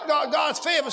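high, loud, pounding sermon delivery; full-force preaching. Real, not acted. Preacher shouting to the church at full force. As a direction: preach it hard to the congregation.

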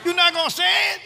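high-pitched preacher's challenge; urgent goading. Real, not acted. Preacher challenges a hesitant congregation to say it, high and urgent. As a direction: push it out high, goading the crowd.

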